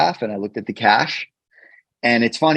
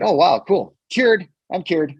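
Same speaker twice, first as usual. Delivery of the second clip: high, sarcastic mock cheer, much higher; sarcastic mock relief; 9 semitones higher than usual. Mocking a friend's naive advice, sarcastic high cheer. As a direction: pretend you're instantly cured, dripping sarcasm.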